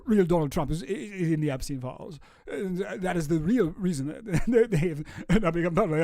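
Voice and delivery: put-on lightly done South African Musk accent, breaking into laughs; a rough, half-there South African Musk voice; Elon Musk impression. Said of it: "seventy percent there to a decent Elon".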